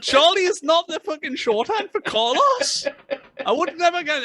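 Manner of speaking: very high-pitched amused disbelief; slow, high, outraged amusement; incredulous. Real, not acted. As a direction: squeal it in disbelief, on the edge of laughter. Laughing disbelief at absurd nickname.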